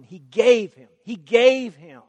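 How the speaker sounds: "gave" hit loud and falling, said twice; stress on gave. Shown as a demonstration. Preacher contrasting send with gave, stressing gave. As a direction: punch the word "gave". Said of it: "God didn't just send".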